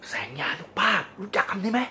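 hushed, mock-secretive; conspiratorial whisper. Mock-mysterious term reveal.

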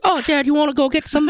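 high, hammed-up bad British accent. Imagined Emma Watson inviting him out, bad British accent. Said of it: "I have a very bad British accent".

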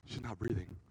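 soft, low frightened thought; scared thought. Retold or imitated. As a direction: hush it out in panic. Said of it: "I was like".

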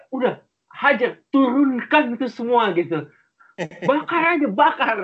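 high-pitched, hammed-up surrender to doom; mock exasperated urging. Telling the heavens to burn it all, mock exasperated. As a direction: egg on the apocalypse in a high voice. Acted giving up on the world.

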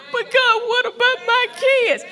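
mock whining. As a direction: whine to God in a put-on voice. Mock whiny believer.

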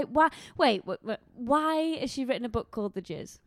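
ordinary talk, nothing marked

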